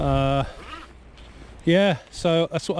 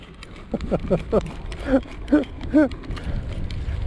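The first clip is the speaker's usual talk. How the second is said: airy helpless laugh at the mishap; real rueful laugh; breathy laugh; 7 semitones higher than usual. Real, not acted. Laughing after losing hat.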